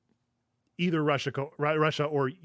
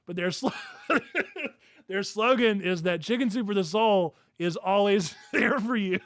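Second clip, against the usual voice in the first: higher-pitched, breaking into laughter mid-sentence; real laughing; 5 semitones higher than usual. Real, not acted. Host cracking up reading a defunct company's slogan, laughing. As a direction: struggle to get the words out through laughter.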